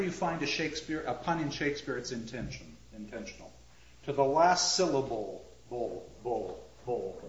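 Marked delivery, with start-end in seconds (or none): 4.1-7.2 last part of "syllable" echoed low as "bull, bull"; pun on syllable. Shown as a demonstration. Lecturer hinting at what it sounds like, pun on syllable. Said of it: "Sounds like what?".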